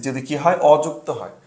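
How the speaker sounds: ordinary talk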